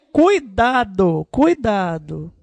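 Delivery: drawn-out cautioning; slow, emphatic "careful"; mock warning. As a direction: stretch each warning out slowly. Acts warning during fast songs.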